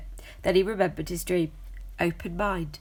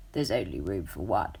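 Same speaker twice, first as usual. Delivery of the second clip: low and gruff; low gruff cat voice; 10 semitones lower than usual. A narrator's reading. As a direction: shut him down in a growl. Tough cat refuses.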